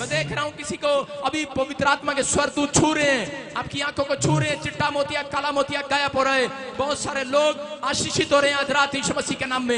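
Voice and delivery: shouted prophetic declarations; loud faith-healer proclaiming; preaching at full force. Acted.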